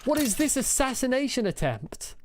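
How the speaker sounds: sudden high alarmed yelp. Real, not acted. Gamer yelps at skeletons ambushing her. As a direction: cry out as arrows hit.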